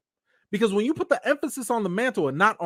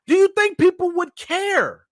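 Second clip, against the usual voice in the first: emphatic challenge; 9 semitones higher, 4 dB louder than usual. Real, not acted. Rhetorical challenge to viewers.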